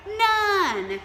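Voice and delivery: loud, high "None"; emphatic answer. Acted. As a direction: call out the answer brightly.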